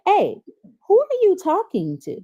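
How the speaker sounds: high-pitched parental call-out; challenging a whining child. Retold or imitated. Adult to a kid who starts whining, high challenge. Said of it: "anytime a kid start whining".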